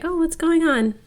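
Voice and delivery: high, lightly voiced Miranda asking; high concerned boss. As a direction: pitch up into a fake-caring question.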